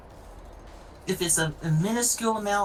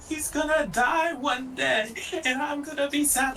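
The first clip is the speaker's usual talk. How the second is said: mock sad roommate; 9 semitones higher than usual. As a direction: whine it like a worried pet parent. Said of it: "she's like".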